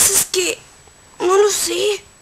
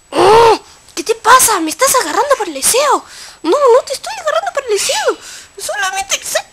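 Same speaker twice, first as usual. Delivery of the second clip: higher and louder, quick offended denial; high indignant protest; 7 semitones higher, 4 dB louder than usual. Acted. Accused character protests to the player in a rising squeaky voice.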